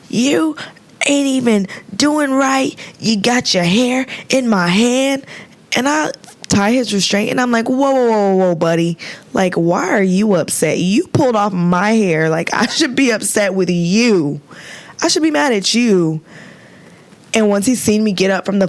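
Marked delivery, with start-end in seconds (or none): 0.1-5.2 higher, indignant mock patient; patient yelling at the nurse, hammed-up indignation; imitating angry patient. Angry patient quoted, pissed off. 7.7-16.2 mock indignant retort. Nurse retorts to patient.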